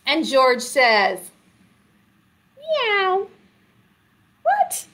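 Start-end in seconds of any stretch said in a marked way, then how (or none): 2.6-3.3 high-pitched meow; high kitty voice for a story; cat meow. Acted. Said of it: "That's a cat that says meow". 4.4-4.9 very high, startled question; playfully astonished; mock surprise. Mock surprise at dog meowing.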